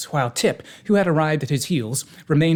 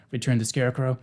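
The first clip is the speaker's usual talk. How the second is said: ordinary delivery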